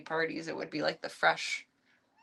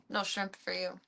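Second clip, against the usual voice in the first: mock soup nazi; 8 dB quieter than usual. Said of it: "No soup for you".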